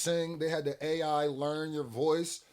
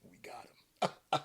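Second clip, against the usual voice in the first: unvoiced conspiratorial whisper; sneaky whisper; 6 dB quieter, slower than usual. Label execs whispering behind [name]'s back. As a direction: whisper it like a scheming villain. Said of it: "They say".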